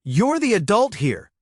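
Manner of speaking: wife snapping at her husband, sharp and loud; snapped. Acted. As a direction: bite it off at him. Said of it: "she snapped".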